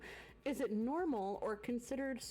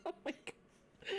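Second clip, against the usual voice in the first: laughing whisper; 7 semitones higher, 5 dB quieter, slower than usual. Real, not acted.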